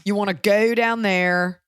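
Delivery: slow Carolina accent, drawn-out fronted O. Shown as a demonstration. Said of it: "They have that here".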